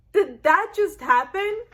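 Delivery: stunned viewer asking; shocked disbelief. Retold or imitated. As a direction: react like you can't believe it. Shocked at death.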